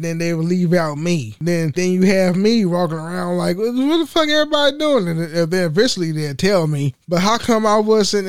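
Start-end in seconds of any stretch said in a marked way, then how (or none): 3.6-5.1 high, fast, baffled mock complaint; confused outburst. Complaining about clique at work, fast baffled outburst. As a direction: rattle off a bewildered question. Imitating himself left out at work.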